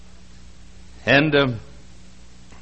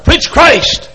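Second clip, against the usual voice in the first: loud high holler; 10 semitones higher, 9 dB louder than usual. Retold or imitated. Preacher voicing Billy Sunday's holler at the headline, loud. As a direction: shout it out. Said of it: "and hollered".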